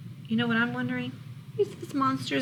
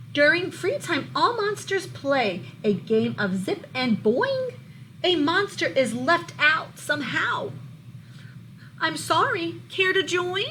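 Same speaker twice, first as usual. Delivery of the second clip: lively, louder storybook read-aloud; reading to children; 6 semitones higher, 5 dB louder than usual. A narrator's reading. Teacher reading a monster book to her class, lively storybook voice. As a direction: bring the picture book alive for the class.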